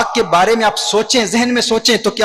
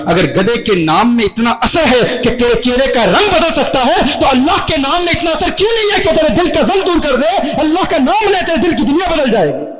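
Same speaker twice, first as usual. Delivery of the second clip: impassioned preaching; 8 semitones higher, 4 dB louder than usual. Retold or imitated. Saint rebukes the philosopher over God's name, impassioned preaching. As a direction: build to a high, ringing climax.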